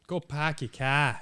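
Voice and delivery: put-on Boston townie accent; mock Boston accent. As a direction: imitate a Boston local, dropping the r's.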